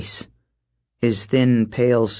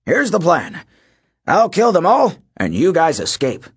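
gruff coach voice; 6 semitones higher, 3 dB louder than usual. Acted. Coach boasting he'll kill them.

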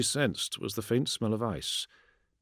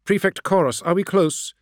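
higher, louder urgent question; urgent call; 10 semitones higher, 6 dB louder than usual. Acted. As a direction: call out to the commander. Calling commander over vox.

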